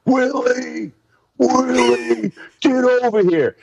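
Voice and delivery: high yelling call from the sideline; imitating coach's scream. Coach screaming for player from sideline, high mock yell. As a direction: holler the name like an angry coach across the field. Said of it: "I can hear B C screaming".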